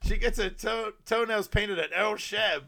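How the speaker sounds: high, loud roast delivery; insult comic act. Retold or imitated. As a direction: perform it to a rowdy club crowd. Acted insult-comic joke on stage.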